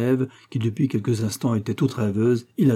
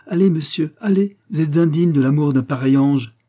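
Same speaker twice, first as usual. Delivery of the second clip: raised, loud scolding; indignant dismissal; 6 semitones higher, 7 dB louder than usual. A narrator's reading. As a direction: send him away with scornful force. Maréchale dismisses unworthy duke. (renvoi indigné, haut et fort)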